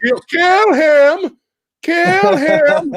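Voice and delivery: yelling at the screen to kill him; chanting kill him at the movie; mock yell. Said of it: "I was just yelling that".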